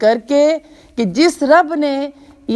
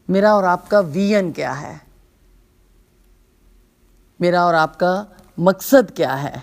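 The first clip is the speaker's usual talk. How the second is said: ordinary talk, nothing marked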